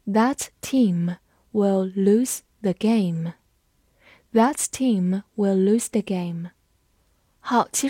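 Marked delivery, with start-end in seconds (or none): 0.1-3.3 very slow, phrase-by-phrase model reading; deliberate, unhurried demonstration with pauses; slow read-along. Shown as a demonstration. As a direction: space the words out for learners to follow. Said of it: "跟着我慢读一遍".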